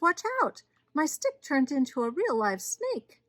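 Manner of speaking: high, loud storybook alarm; startled yell. A narrator's reading. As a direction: yell as if a snake just appeared. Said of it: "yelled Moses".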